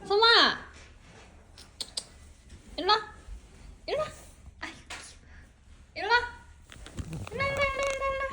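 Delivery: high coaxing calls; talking to a dog. Real, not acted. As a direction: call the puppy over sweetly. Calling the dog over. (강아지를 부르는 다정한 말투)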